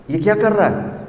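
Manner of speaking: high, wondering mother's voice; amazed mother. Retold or imitated. Mother lifting her newborn, asking in high wonder. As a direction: marvel at the baby like his mother.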